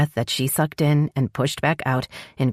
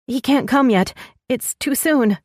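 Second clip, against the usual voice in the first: frightened; 9 semitones higher than usual. Acted. Frightened mother in labour.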